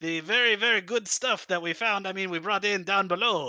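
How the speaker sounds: high, eager broken-English host; dictator voice. Acted. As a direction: sell the barrel with pushy charm. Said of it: "this dictator voice".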